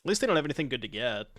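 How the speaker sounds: normal talking voice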